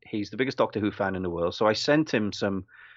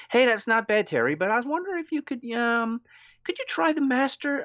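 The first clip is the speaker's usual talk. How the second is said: imitating a friend; 13 semitones higher than usual. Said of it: "He goes".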